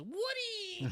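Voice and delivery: high, drawn-out mock yell; imitated yell. Imitating him yelling as he throws it, high yell. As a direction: holler the name up high. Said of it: "he throws the thing up and he yells".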